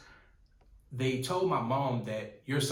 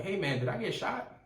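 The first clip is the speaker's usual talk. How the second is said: higher, dazed panicked question; retold freaking out; 8 semitones higher than usual. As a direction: act out his own shocked disbelief. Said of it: "I was freaking out".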